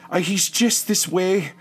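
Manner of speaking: high, loud youngster calling back; young voice. Acted. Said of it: "The young voice comes from around the bend".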